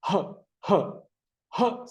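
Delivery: short, puffed "ഹ", like panting; "ഹ" as a quick burst of breath; panting h sound. Shown as a demonstration. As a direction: puff out "ഹ" as a breathy phonics sound. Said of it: "കിതയ്ക്കുന്ന പോലെ".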